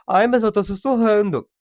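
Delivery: put-on defensive comeback; indignant mock protest. Acted protest of loud whisperer.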